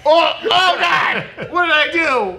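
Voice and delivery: high, loud panicked wail; mock horror. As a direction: cry out like waking to a terrible mistake, hammed-up. Acts waking up with face tattoo.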